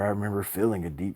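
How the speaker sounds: ordinary delivery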